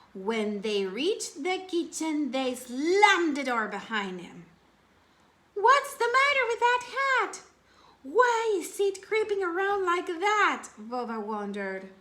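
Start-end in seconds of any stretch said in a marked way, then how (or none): 5.5-10.7 put-on high, loud startled child's voice; alarmed boy. Boy asks his friend about the creeping hat, alarmed and high. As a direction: squeak it out like a spooked kid.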